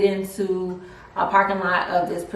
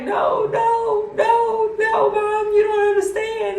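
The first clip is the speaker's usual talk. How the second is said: high, raised pleading no no no; upset protest; 12 semitones higher, slower than usual. Retold or imitated. Daughter crying to mom, high raised pleading.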